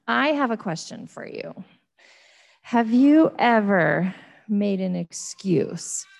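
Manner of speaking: normal talking voice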